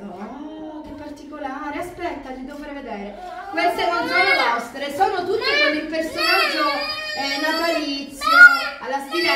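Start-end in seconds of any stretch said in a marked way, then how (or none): 3.5-9.3 excited. Real, not acted. Excited unboxing Christmas mugs.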